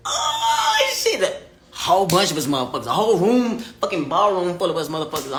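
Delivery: loud, high whooping astonishment; acted awestruck outburst, starting very high; overwhelmed amazement. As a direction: burst out, thrilled at the sight. Acts out amazement at conference.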